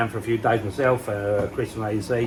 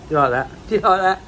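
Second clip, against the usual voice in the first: teasing higher do you like that with a laugh; flirty tease; 8 semitones higher, 5 dB louder than usual. Acted. Husband teasing his missus about the pond air, playful. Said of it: "The missus loves it when I say that".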